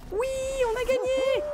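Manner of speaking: real burst of joy; joyful cry. Real, not acted. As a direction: sing out the win, thrilled. Winning the round.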